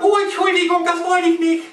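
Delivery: high, squeaky little snake apologising; squeaky apologetic snake voice. Acted. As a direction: play the blind snake, high and polite. Blind snake voiced in sketch.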